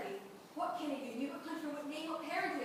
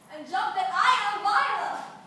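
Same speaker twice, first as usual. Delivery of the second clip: high, loud, slow proclamation; joyful revelation; 7 semitones higher, 15 dB louder, slower than usual. Acted. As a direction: cry out who you really are. Revelation of identity.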